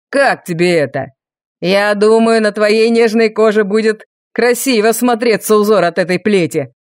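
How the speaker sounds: menacing villain. A narrator's reading. Villain threatens with whip.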